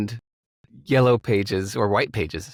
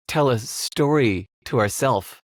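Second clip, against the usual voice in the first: usual speaking voice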